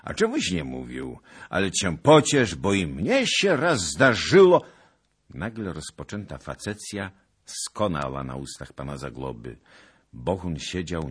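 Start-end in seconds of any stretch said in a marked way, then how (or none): 0.0-4.6 put-on loud, jolly old-noble voice; jovial teasing. Zagloba teasing Bohun about the girl, loud and jolly. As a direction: rib him like an old friend. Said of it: "rzekł pan Zagloba".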